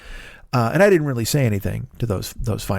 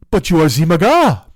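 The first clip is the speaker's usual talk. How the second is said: loud, higher mock French accent; shocked French accent; 4 semitones higher, 7 dB louder than usual. French passenger accusing shaved-head driver, shocked French accent. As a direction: blurt it in hammed-up French astonishment. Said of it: "he almost gasped".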